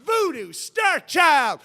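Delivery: high, slow, shouted rock-star hype; hyped announcer. Pretend. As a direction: bellow it like a radio countdown host crowning a legend. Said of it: "picturing myself on the cover of Rolling Stone magazine".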